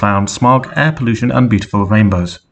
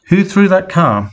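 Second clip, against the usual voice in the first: much higher, furious yell of the question; angry shout; 10 semitones higher than usual. A narrator's reading. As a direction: demand to know who did it, raging. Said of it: "Celestia shouted angrily".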